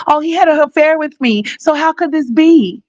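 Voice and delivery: high, whiny mock plea; mimicking the mother's story to the judge, high and whining; mock pleading. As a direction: play her sob story high and pitiful. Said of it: "You finna tell".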